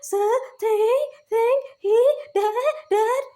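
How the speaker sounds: high, loud, slow, whining put-on voice that cries out; mocking the manipulative voices. Parroting the manipulative voices in his ears, high loud whine. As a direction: drag out their taunt, high and wailing. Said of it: "these manipulative fucking voices that be like".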